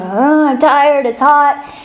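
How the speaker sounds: imitated kid grumbling; whiny kids. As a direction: whine it like worn-out children. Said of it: "they were so whiny".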